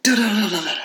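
rapid high, staccato "da-da-da"; rattling noise made with the voice; imitated door rattling. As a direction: mimic a door being shaken back and forth. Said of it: "start shaking my door".

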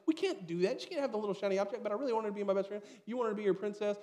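wife in the story spiralling over hurt feelings, hammed-up and fast; fretting wife. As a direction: rattle off every worry like the anxious mom. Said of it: "My wife's like".

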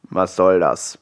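low wolf growl; a low, grumbling wolf asks. A narrator's reading. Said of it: "knurrte er".

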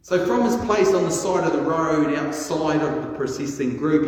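ordinary talk, nothing marked

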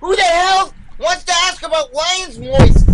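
put-on high, loud bratty kid yell; angry child. Kid yelling at sister.

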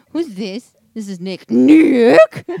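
male caller faking a woman; man doing a woman's voice. Pretend. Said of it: "Do I sound like a girl?".

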